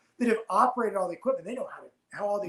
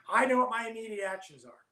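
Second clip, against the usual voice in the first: ordinary talk, nothing marked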